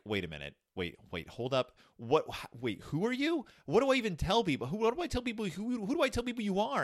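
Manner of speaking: high, rushed, panicky questions; flustered protest. Retold or imitated. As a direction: voice jumping up in alarm. Moses balks at God's command.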